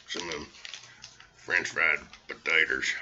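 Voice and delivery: a hint of a slow Southern drawl. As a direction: stretch French fried potatoes out slowly in a Southern accent. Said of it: "Sling Blade".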